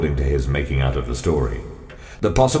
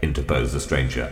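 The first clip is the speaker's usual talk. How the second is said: ordinary delivery, nothing marked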